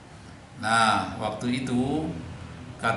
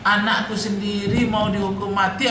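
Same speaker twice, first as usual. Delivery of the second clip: raised, pitched-up divine voice; voice of God; 9 semitones higher than usual. Acted. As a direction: speak as God, voice lifted high. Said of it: "sedangkan Tuhan sendiri mengatakan". (suara Tuhan yang ditinggikan)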